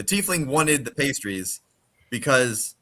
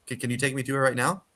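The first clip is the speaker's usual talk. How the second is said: eager and rushed; 3 dB quieter, faster than usual. Acted. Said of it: "And she goes".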